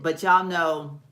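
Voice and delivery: ordinary talk, nothing marked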